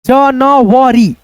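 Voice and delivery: very slow, syllables pulled apart; January sounded out very slowly; sounding out. Shown as a demonstration. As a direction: spell out January ja-na-va-ri. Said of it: "जनवरी पढ़ेंगे".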